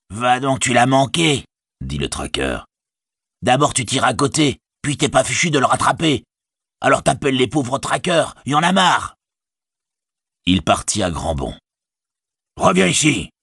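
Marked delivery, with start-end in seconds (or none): 0.1-9.1 higher, jeering orc voice fed up; mocking orc tracker. Orc tracker scoffing at the soldier's missed shot, jeering. 12.6-13.3 orc cry. Acted. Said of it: "s'écria le soldat".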